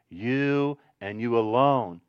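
slow, low, stretched "You" and "alone"; emphatic YOU. Shown as a demonstration. Jesus speaking to his disciples, slow stress on "You" and "alone". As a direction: hold "You" long before "and you alone". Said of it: "he speaks it with the emphatic voice".

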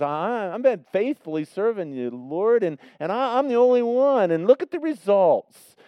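high, loud aggrieved complaint; complaining Elijah. Retold or imitated. Elijah protesting to God, loudly aggrieved. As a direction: whine that you alone stayed faithful. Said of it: "in essence, he says".

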